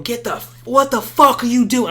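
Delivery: high, loud yell at the brawling friends. Retold or imitated. Yelling at drunk friends fighting in his house, fed up and loud. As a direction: shout them down, fed up. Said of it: "I'm yelling now".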